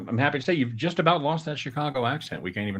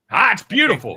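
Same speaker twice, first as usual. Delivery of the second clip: mock weatherman; 8 semitones higher, 8 dB louder than usual. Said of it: "you've got a future as a weatherman".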